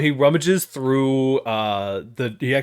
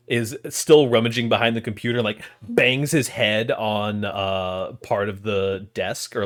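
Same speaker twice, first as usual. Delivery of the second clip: ordinary talk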